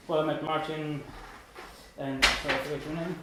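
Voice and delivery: usual speaking voice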